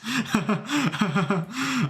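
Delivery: high burst of real laughter; helpless giggling at a silly trick; laughing. Real, not acted. Streamer cracking up at own avatar effect, high laughter.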